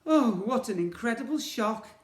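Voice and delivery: high loud mock-shocked sarcasm; sarcastic. Boy mocking the twins' scare, sarcastic. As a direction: pretend to be shocked, dripping sarcasm. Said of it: "said the boy sarcastically".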